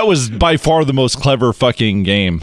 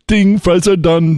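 fast food worker voice; 6 semitones higher, slower than usual. Retold or imitated.

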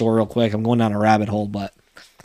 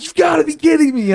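high, strained yell held back in volume; mock yell. Son fuming alone in his car at dad's lucky buck, mock yelling. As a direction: yell it in high-pitched outraged disbelief, without full volume. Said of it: "I'm yelling. I'm like".

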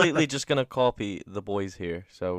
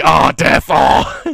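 loud hammed-up cry of despair; mock dramatic death cry; mock anguish wail; 13 dB louder, slower than usual.

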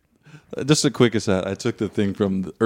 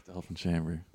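sighing; 5 semitones lower, 17 dB quieter than usual. Real, not acted. Sighing repeat of title.

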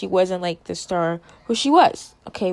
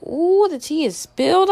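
sassy gossip; 8 semitones higher, 4 dB louder than usual. Retold or imitated.

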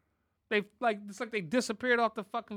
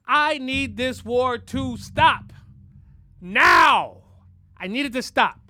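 loud, drawn-out plea peaking on a shouted "Now"; emphatic demand; 2 semitones higher, 8 dB louder, slower than usual. Real, not acted. Fed-up man demanding the war stop now, loud and emphatic. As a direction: demand it loudly, stretching the words out.

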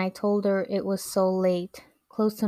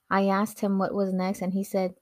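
plain reading voice

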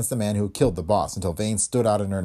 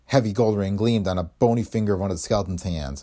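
ordinary delivery, nothing marked

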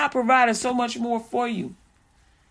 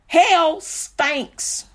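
ordinary talk, nothing marked

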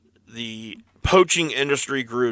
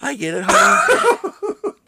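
high wife voice; 6 semitones higher than usual. Acted. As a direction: soothe him like a mock housewife. Playing wife to stressed husband.

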